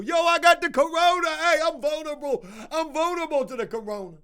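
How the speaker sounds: high, loud whiny inmate begging to get out; hammed-up prisoner wailing he's vulnerable; mock sick prisoner. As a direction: shout the fake complaint up high like a joking prisoner. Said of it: "Prisoners are probably making jokes".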